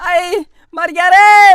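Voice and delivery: slow hammed-up cry of pain; pain wail. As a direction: wail like you're dying. Boy beaten by mother.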